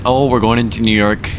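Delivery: ordinary talk, nothing marked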